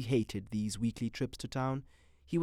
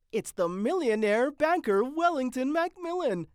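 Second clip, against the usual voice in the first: high, louder nurse voice; pretty nurse; 13 semitones higher, 5 dB louder than usual. A narrator's reading. Nurse announcing a patient, high voice.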